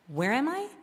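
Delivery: put-on a small-town girl dazzled by the city; wide-eyed wonder. To her sorority sister, stunned by the city, awed. Awed first visit to New York.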